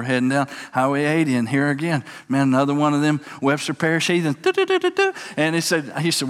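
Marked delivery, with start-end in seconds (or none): 4.4-5.1 high beeping radar noise; radar beep imitation. Preacher telling the church how the radar went off.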